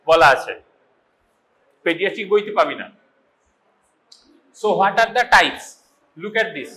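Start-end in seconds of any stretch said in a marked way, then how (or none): none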